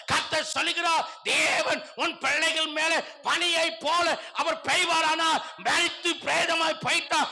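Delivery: high, loud, relentless sermon delivery; full-force preaching. Real, not acted. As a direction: preach it to the back row. (முழு வேகப் பிரசங்கம்)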